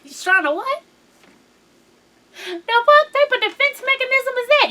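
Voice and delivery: high, loud disbelieving squeal; shrill outrage at an absurd excuse; incredulous. Retold or imitated. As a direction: act like you can't believe what you just heard. Incredulous reaction to bully claim.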